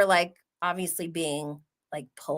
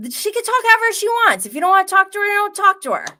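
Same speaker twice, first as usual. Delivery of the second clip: mock boyfriend's defense; 13 semitones higher, 7 dB louder, much faster than usual.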